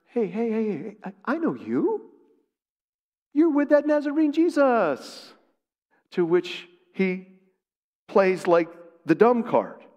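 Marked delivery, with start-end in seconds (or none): 0.0-5.3 put-on higher, pointed accusing voice of a servant girl; a girl in the courtyard accusing Peter, high and pointed; accusing slave girl. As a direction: call him out like a suspicious servant girl. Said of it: "says to Peter".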